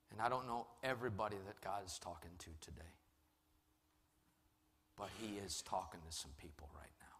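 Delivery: soft, low closing appeal; quiet pastoral invitation, nearly whispered at times; hushed altar call. Real, not acted. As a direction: drop to an intimate, sincere hush.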